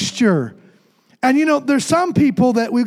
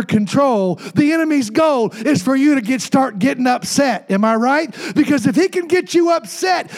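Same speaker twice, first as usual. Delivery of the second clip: higher, insistent preacher's build with shouted peaks; preaching at full force; 6 semitones higher than usual. Acted. Pastor warning churchgoers against getting upset, shouted preaching. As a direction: drive it home like a revival preacher. Preaching about the devil's goal.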